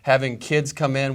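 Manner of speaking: ordinary delivery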